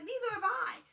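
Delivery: high, loud mimicked protest; mock indignant outburst. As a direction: blurt it out, imitating an indignant interruption. Retold self-centred outburst in argument.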